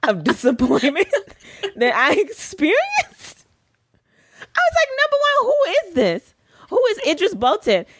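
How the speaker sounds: high-pitched laughing disbelief. Real, not acted. Host recalling the wrong Idris following her, laughing high. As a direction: squeal the question through a laugh.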